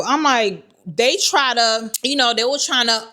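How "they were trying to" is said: usual speaking voice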